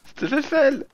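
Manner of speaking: high teasing call of the name; playful teasing. Real, not acted. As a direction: tease with a singsong. (taquin, voix aiguë)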